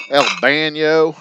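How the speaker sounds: "El baño" drawled slowly in a Texas accent, "baño" as "BAN-yo"; Texan accent on Spanish. Shown as a demonstration. Showing off his Texan Spanish, slow Texas accent. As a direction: stretch out "El baño" in a thick Texas twang. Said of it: "Even my Spanish words have a Texan accent".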